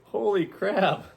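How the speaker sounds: high, loud mock protest with a laugh behind it; mock outraged yelp. Real, not acted. Husband squealing in mock shock at a double meaning, loud and high.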